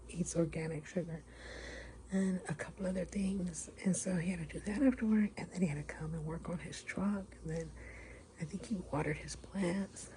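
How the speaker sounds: hushed whisper. Real, not acted. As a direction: keep it down, whisper. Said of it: "whisper 'cause he can hear me".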